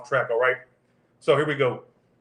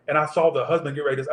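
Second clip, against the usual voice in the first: usual speaking voice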